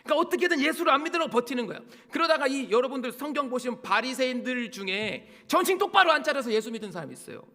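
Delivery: loud, high, urgent preaching; full-force preaching. Acted. Preacher pressing the congregation about unbelief, full-force preaching.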